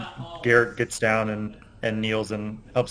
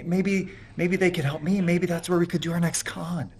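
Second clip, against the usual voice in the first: higher, pleading, stumbling over words; eager young con man; 7 semitones higher than usual. Acted. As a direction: sell the scheme, nervous and hopeful. Player voices Garrett pleading.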